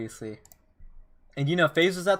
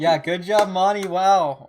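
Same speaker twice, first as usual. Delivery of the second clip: loud, high congratulations; cheering; 5 semitones higher, 10 dB louder, slower than usual. Real, not acted. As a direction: cheer the winner on. Cheering the tournament winner.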